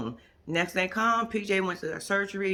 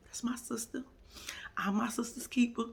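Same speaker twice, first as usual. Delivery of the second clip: soft, whispered loyal excuse; mock hush, barely voiced; 5 semitones higher, 6 dB quieter than usual. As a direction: whisper it like a secret. Imitating Lauren's hushed excuse.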